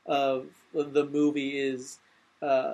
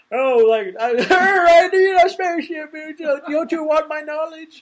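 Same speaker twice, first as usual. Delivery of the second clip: loud, very high mock-desperate plea; hammed-up whiny deity, voice pitched way up; flustered God; 13 semitones higher, 11 dB louder than usual. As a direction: splutter it loudly and high. Acting out God dodging the question.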